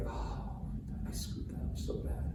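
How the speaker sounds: whispered regret; ashamed whisper. Retold or imitated. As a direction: breathe it out like a guilty secret. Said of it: "behind closed doors, they're like".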